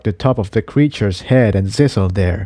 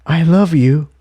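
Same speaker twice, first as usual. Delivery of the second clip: breathless; 6 semitones higher than usual. Acted. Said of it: "Tiptoe said breathlessly".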